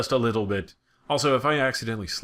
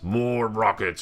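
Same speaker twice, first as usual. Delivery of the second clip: slow, savoring mock craving; drawn-out greedy echo; 4 semitones higher, 4 dB louder, slower than usual. Gamer picking research, slow mock-greedy echo. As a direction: drag it out like you crave them.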